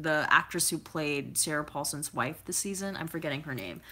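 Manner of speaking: ordinary talk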